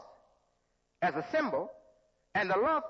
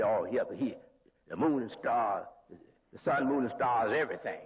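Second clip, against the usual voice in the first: imitating a doubter; 10 semitones lower than usual. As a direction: lower voice, mocking a listener's objection. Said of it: "I know what you say".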